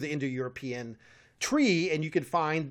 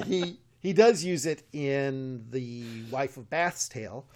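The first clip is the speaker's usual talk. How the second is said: ordinary talk, nothing marked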